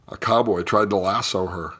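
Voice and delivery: plain reading voice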